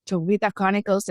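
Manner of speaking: normal talking voice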